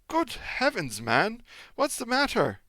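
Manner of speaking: high, loud shocked cry; startled outcry at the doorway; cry of surprise. Acted. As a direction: gasp it out at the sight of him. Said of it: "I gave a cry of surprise".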